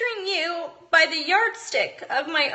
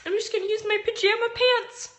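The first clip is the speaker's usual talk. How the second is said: mock mom logic; 6 semitones higher, faster than usual. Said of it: "when you are like".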